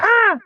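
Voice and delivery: excited exclamation. Real, not acted. Excited cry.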